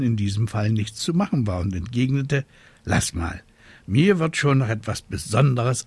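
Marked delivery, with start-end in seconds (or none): none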